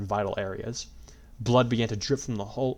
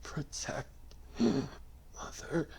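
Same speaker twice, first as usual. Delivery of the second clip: whisper; 5 semitones higher, 8 dB quieter, much slower than usual. A narrator's reading. Dying whisper.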